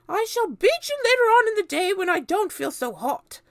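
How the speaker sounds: very high, loud cruel aunt; a witch promising a beating; Aunt Spiker threatening. A narrator's reading. Aunt Spiker threatening James, high and loud. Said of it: "she said".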